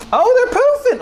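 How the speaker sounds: high, loud burst of delight; thrilled cooking squeal; excited shriek. Real, not acted. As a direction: squeal it out as the eggs rise. Eggs puffing up in the pan.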